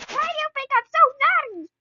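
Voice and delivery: very loud, high-pitched yell; acted-out protesting shriek; retold indignant outburst. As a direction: shout back as if wrongly accused.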